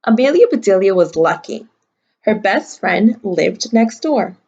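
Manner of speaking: ordinary delivery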